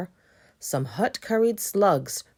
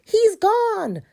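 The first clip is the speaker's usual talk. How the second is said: pumpkin voice; 8 dB louder than usual. Acted. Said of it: "they declared".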